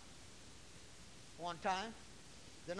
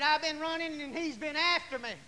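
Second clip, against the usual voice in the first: loud, higher imitated wail; mock squalling testimony; 6 semitones higher, 8 dB louder than usual. Preacher imitating daughter testifying about the devil, squalling.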